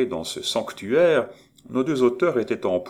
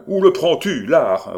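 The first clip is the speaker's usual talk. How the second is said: much higher, loud furious roar; angry roar; 8 semitones higher, 9 dB louder than usual. Acted. Étienne roaring angrily at Maurice about art. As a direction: roar it back in anger. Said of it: "rugit Étienne en colère".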